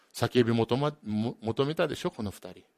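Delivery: ordinary delivery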